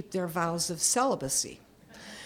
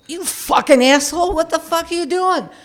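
high, loud road-rage shout; lost it, furious yell; 8 semitones higher, 9 dB louder than usual. Retold or imitated. Grandma cursing at a mini that cut her off, furious shout. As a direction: blast the driver who cut you off. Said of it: "I lost it".

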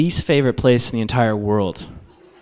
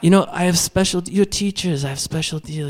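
higher-pitched French Canadian sales pitch; French Canadian accent; 5 semitones higher than usual. Acted. Saleswoman offering teachers a special deal, high French Canadian accent. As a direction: coax with a Montreal French accent. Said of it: "French Canadian from Montreal".

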